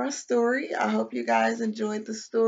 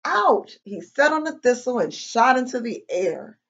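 animated read-aloud with bouncy pitch; children's story narration; 2 semitones higher, 6 dB louder than usual. A narrator's reading. Reading a picture book to kids, lively storytelling. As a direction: tell it to little listeners, pausing before each mishap.

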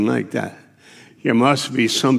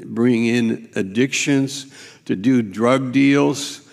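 usual speaking voice

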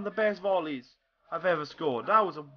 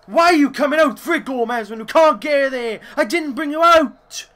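angry rant; 5 semitones higher, 9 dB louder than usual. Real, not acted. Ranting at keeper Gomez.

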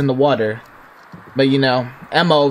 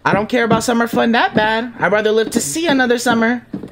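pleading, high voice; 7 semitones higher than usual. Pretend. Player pleading with game character Emma.

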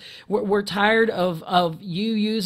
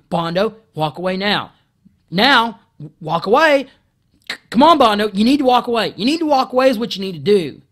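higher, louder escalating order; ordering the coworker out, shouting; loud repeated command; 3 semitones higher, 8 dB louder than usual. Retold or imitated. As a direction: keep hammering it, getting louder. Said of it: "I just repeated that".

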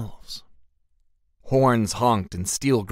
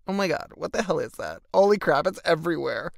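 higher, appalled outburst; disgusted shock; 6 semitones higher, faster than usual. Acted. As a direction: recoil at a foul smell. Hero smells something foul.

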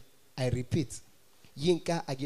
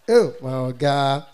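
ordinary talk

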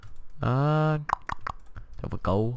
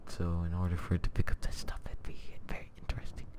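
whispered aside; whispering; 8 dB quieter, faster than usual. Real, not acted. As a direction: whisper it to yourself. Drops to an aside.

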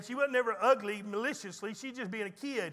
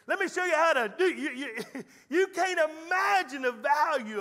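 higher, louder preaching voice with laughter; 6 semitones higher, 5 dB louder than usual. Real, not acted. Pastor preaching about the kids to his church. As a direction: lift it up and drive it to the pews.

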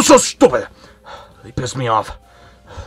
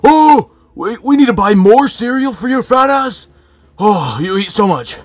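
high, loud nagging wife voice; hammed-up high scolding squeal; mocking Peach; 10 semitones higher, 5 dB louder than usual. Bowser mimics Peach nagging about cereal, high and loud. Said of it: "Peach is like".

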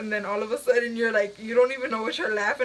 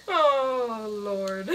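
ordinary talk

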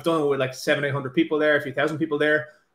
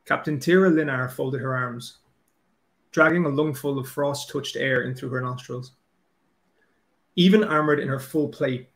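put-on slow, measured reading in an Irish accent; story reading. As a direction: read the prologue aloud in an Irish accent. Reads prologue in Irish accent.

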